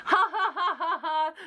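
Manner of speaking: high burst of laughter at herself; bright ha ha ha laugh; real laugh. Real, not acted. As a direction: laugh at your own silly mistake. Laughing at her own blunder.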